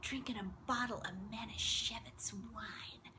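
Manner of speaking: slow, whispered sleazy brag; imitating a hushed phone creep; creepy date's whisper. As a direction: whisper seductively and badly, dragging it out. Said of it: "just like he talked".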